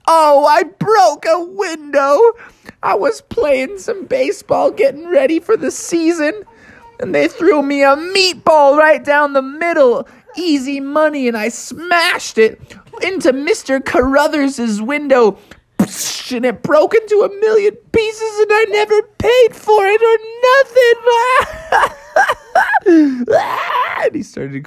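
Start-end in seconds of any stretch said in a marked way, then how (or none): 0.1-22.0 high squeaky frog confession; confessing frog. Acted. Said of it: "he's like". 22.5-24.0 very high squealing wail; high frog wail; high crying wail. Acted. Froggy breaks down.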